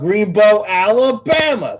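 slow, high Southern drawl stretched wide; playful Alabama drawl, drawn out slow and high; Forrest Gump Southern drawl. Retold or imitated. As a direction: stretch the place name out like a Southern movie hero. Evoking Forrest Gump's Alabama hometown.

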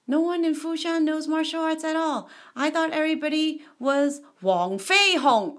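put-on challenger voice; rude challenger. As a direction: announce it rudely to the whole town. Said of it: "here comes this guy, just rudely".

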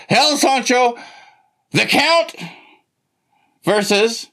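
high, slow wrestling introductions; ring announcer. Pretend. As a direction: roll out each wrestler's name like an announcer. Announces wrestlers of the tag match.

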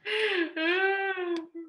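mock crying sigh; whimpering sigh; high wail sigh. Real, not acted. Exasperated after joke.

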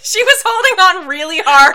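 loud, high, laughing through the words; real laughter spilling into a shout. Real, not acted. Laughing at crying cohost.

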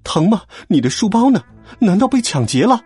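high, frantic string of questions; clamoring, alarmed mother. A narrator's reading. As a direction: fuss in a panic over a hurt son. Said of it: "又是一番大呼小叫".

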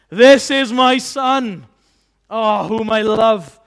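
loud, slow, exultant proclamation; bursting with praise. Acted. Father praising his Son at the baptism, bursting with praise. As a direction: burst out proudly, drawing out each phrase. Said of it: "the Father will just burst out in praise".

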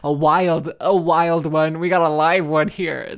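loud, thrilled exclaiming; excited exclaiming. Retold or imitated. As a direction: blurt it out delighted. Excited retelling of own reaction.

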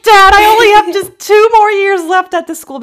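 high, loud whiny complaint; pleading with dad, voice pushed up high; retold teenage outburst, high and loud; mock protest to dad. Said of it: "I was like".

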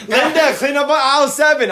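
high, laughing impression; hammed-up store page; mock store announcer. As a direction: call it out high through laughter. Acting out store cleanup announcement.

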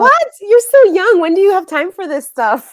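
loud, high-pitched real astonishment; amazed. Real, not acted. Teacher reacts to young student's many languages, amazed. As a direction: burst out in disbelief, voice shooting up.

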